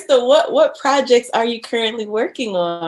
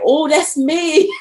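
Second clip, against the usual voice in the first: knowing recognition; 7 semitones higher, slower than usual. Retold or imitated. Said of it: "I'm like".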